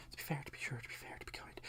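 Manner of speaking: whispered Irish warm-up; hushed, unvoiced Irish lilt under the breath; Irish accent. Shown as a demonstration. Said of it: "do a really nice Irish accent".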